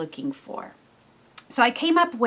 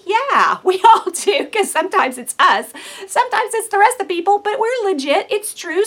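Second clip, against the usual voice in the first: high, louder laughing admission; laughing aside; 8 semitones higher, 5 dB louder than usual. Real, not acted. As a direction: laugh it off together. Laughing at crazy households.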